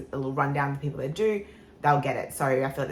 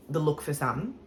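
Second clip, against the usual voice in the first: ordinary talk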